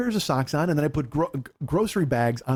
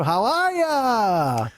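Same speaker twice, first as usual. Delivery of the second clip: slow, drawn-out, higher talk-show host greeting; Bobby Pittman voice; 6 semitones higher, 3 dB louder, much slower than usual. Retold or imitated. Greeting the talk show audience, slow and stretched. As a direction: stretch it out like a smarmy comic host. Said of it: "Bobby Pittman on his fucking talk show".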